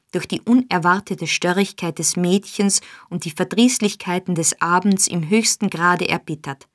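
usual speaking voice

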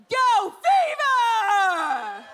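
loud drawn-out hype shout; excited shout. Acted. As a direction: whoop the name out slowly. Announcing nominee with a whoop.

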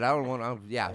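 normal talking voice

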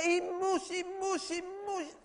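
mother dwells on it, fading; fading repetition. Acted. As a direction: let the words die out. Repeats, fading.

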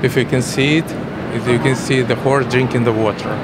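natural Russian accent in English; halting English with a Russian accent. Real, not acted. Ukrainian painter speaking English.

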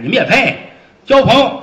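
high, loud mocking question; scornful sneer. Fat officer mocking the men's friendship, high and scornful. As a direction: scoff at them.

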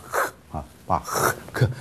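"呵" as a voiceless throat scrape; heavy guttural CH. Shown as a demonstration. Teacher isolates the C H sound, guttural "呵". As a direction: breathe a rough German ch on "呵", no voice. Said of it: "那个C H很重的那个喉音".

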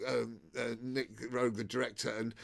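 normal talking voice

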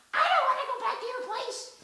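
high, loud put-on yell; shrill shout. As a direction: shriek it like a panicked woman. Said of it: "and you go".

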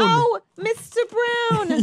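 effusive staff greeting. Acted. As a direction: greet a favourite customer, stretching it out. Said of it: "And the staff greets you?".

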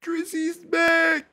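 a high, stretched-out cry of joy; excited hype. Real, not acted. A fan hyping the return, stretched high. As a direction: crow it out high and long. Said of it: "excited hype".

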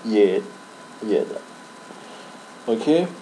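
normal talking voice

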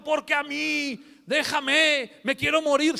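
high, slow anguished wail; despairing cry. Acted. A saint crying out to God, slow anguished wail. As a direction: cry out wanting to die.

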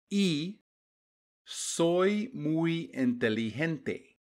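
slow, clear sentences with long gaps; slow read-along. Shown as a demonstration. Teacher reading slowly for the class to repeat after each line. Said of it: "As I say each sentence, repeat it out loud".

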